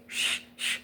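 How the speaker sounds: imitated calming "shh, shh"; soft shushing. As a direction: shush someone quickly and softly. Staff hushing frantic mother.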